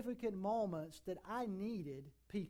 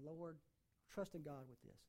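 quiet prayer; 5 semitones lower, 11 dB quieter than usual. Retold or imitated. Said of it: "I said".

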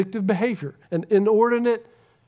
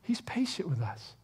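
soft unvoiced whisper; 2 semitones lower, 12 dB quieter than usual. Real, not acted. Preacher reassuring his congregation, whispered. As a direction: breathe it to the room. Sermon hush, closing the point.

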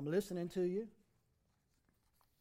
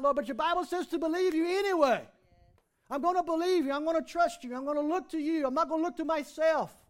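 loud, higher preaching voice; fiery preaching; 6 semitones higher, 8 dB louder than usual. Acted. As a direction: drive the confession home to the congregation. Preaching at full force.

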